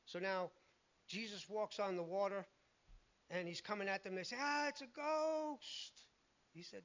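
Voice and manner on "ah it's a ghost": high, slow, drawn-out cry of fright; spooked disciples wailing in alarm; frightened cry. Retold or imitated. Disciples in the storm boat, slow high frightened wail. Disciples see figure on water.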